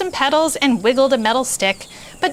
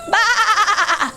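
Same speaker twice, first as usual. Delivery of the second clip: a high bleating baa; high sheep baa; 11 semitones higher than usual. A narrator's reading. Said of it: "said Sheep".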